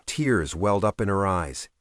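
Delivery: ordinary delivery, nothing marked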